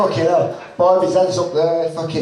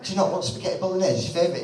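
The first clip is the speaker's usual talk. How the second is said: fast puzzled dad; 6 dB quieter, much faster than usual. Retold or imitated. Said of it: "I'm like".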